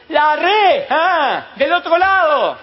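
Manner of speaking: high, loud, slow call across the water; shout from the shore. Acted. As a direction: bellow it across to the fishermen. Stranger insists from the shore.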